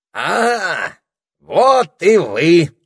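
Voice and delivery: high, loud greeting with stretched vowels; громкое приветствие. A narrator's reading. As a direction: call out a drawn-out welcome. Попугай громко приветствует детей. (громкий протяжный оклик)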